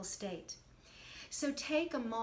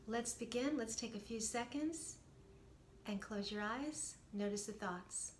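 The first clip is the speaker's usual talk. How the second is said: guided meditation; 10 dB quieter than usual. Acted. Coach guiding viewers through a short meditation, soft and calm. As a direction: guide them gently into stillness.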